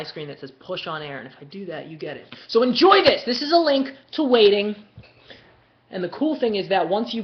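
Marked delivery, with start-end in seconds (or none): 2.5-4.8 high, loud and triumphant; hyped announcement. Real, not acted. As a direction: announce it loudly to the crowd. Pushing link out to viewers.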